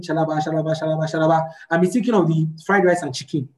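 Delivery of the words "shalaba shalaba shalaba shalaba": low, rote mock tongues; mock praying in tongues. Hungry believer praying with mind on food, rote mumbo-jumbo. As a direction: rattle off absent-minded mock prayer syllables. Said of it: "be saying".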